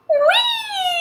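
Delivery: very high, loud, drawn-out "wheee"; sustained high whee, sailing through the air; long whee. Pretend. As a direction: squeal a parachute jump on one big breath. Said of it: "using our voice, taking a deep breath".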